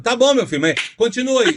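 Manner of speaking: high, teasing lady voice; mocking old lady. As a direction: dare him to go on. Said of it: "e ela lá do outro lado". (senhora debochada provocando)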